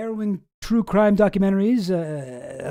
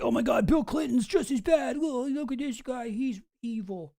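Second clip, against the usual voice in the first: higher, soft put-on scandalized voice; mock outraged conservative; 5 semitones higher, 4 dB quieter than usual. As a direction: imitate a shocked pearl-clutcher, pitched up. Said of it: "I said it in that, in that voice".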